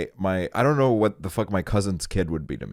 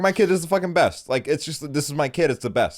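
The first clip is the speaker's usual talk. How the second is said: entitled parent; 8 semitones higher than usual. Retold or imitated. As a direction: brag like a proud entitled dad. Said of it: "entitled parents where I'm like".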